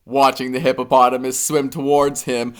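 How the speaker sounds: ordinary delivery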